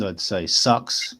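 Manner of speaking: slow New Zealand "sucks"; Kiwi accent. Shown as a demonstration. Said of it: "if I was going to say it with a Kiwi accent".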